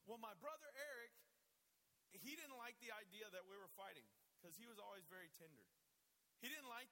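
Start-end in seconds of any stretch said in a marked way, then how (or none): none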